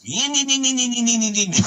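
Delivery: a buzzing high motor noise on the lips; imitated dirt bike engine. Telling Jonathan about a kid's bike, high engine buzz. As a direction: mimic a dirt bike revving up nearby. I hear this.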